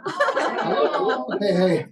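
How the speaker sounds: squeaky puppet hello. Acted. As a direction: greet the crowd like a squeaky puppet. Puppet greets in high voice.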